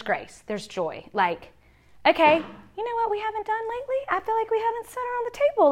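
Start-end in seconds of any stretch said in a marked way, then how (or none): 2.1-5.7 much higher, put-on parent voice; high chirpy suggestion. Mom proposing they sit around the table, high put-on voice. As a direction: pitch it way up like a gentle family nudge.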